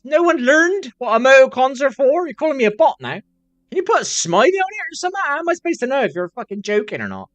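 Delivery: high, loud, exasperated protest; indignant high rant. Real, not acted. Streamer scolding a chatter who called him a bot, loud and high. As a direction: fire back, stung and baffled.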